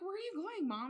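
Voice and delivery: lightly voiced daughter calling after mom; concerned daughter. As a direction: ask it high, puzzled.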